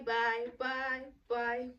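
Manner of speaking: ordinary talk, nothing marked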